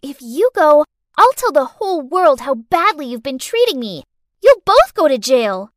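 low, loud bratty threat; threatening child. Acted. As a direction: threaten them hard.

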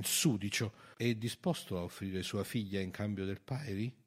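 plain reading voice

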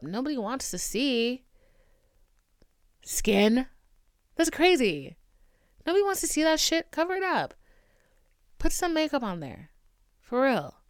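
loud, high mocking voice; exaggerated sarcastic rant; mocking sarcasm. Mocking beauty standards, exaggerated.

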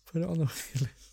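laughing through the words. Real, not acted. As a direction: chuckle while repeating it. Echoes phrase laughing.

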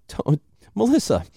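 high-pitched teasing with a chuckle; laughing about it. Acted. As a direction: gently tell a friend, laughing along. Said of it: "you can laugh about it when you're saying it".

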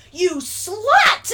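high, loud mock yell; acted outburst. Boy lashing out at a girl on a reality show, mock yell. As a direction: yell it like a drunk boy's insult. Retold boy's drunken insult.

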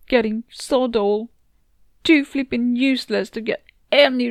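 distraught self-berating rant, pitched above the narration; frustrated narrator's outburst. Acted. Berating herself for letting everyone down, frustrated and upset. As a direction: rant at yourself in rising frustration and despair.

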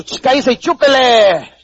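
mock scolding command; bossy hush. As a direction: shut it up like a nagging kid. You hushing your body.

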